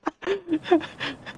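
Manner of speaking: high, thin giggling; disbelieving laugh. Real, not acted. Player not believing the game, high giggling. Calls the game full of shit.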